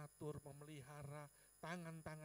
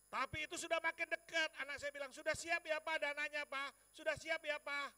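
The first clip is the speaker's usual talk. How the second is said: loud, high, insistent retelling; urgent pressing; 9 semitones higher, 10 dB louder than usual. Retold or imitated. Child asking father if the money is ready, urgent loud plea.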